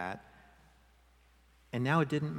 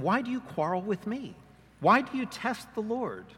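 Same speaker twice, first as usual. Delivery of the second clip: higher, pleading rebuke; exasperated Moses; 8 semitones higher, 4 dB louder than usual. Acted. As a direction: push back at the grumbling people. Moses answers the quarreling people.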